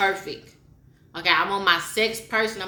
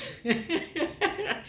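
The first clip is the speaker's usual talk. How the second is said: relieved laugh; 11 semitones higher, 5 dB quieter than usual. Real, not acted. Relieved laugh after bad cooking.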